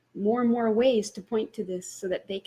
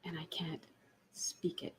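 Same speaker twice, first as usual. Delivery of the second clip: voiceless near-silent whisper; words dying into breath; whispered, overcome; 4 semitones lower, 15 dB quieter than usual. Real, not acted. Overwhelmed silently.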